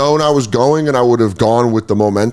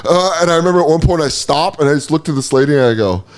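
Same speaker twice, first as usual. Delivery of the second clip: usual speaking voice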